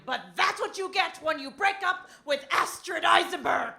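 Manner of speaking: loud, high, grandiose declaration; elderly mother quoted. Retold or imitated. Mother boasting after a breakup, loud self-important proclamation. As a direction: build to your own name with a proud shout. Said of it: "She goes".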